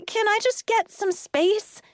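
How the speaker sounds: high, exasperated request; exasperated plea. A narrator's reading. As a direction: beg for room, fed up. Becca, crowded, raises her voice.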